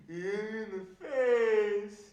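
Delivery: loud, high, drawn-out wail; hammed-up slow, tearful howl; mock crying child. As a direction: bawl it like a wronged little kid. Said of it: "this kid was crying".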